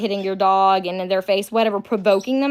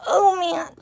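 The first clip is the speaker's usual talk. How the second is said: stubbed toe; 9 semitones higher than usual. Real, not acted. After stubbing her toe.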